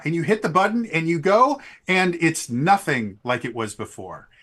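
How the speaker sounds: usual speaking voice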